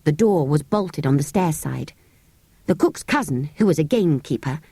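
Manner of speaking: plain reading voice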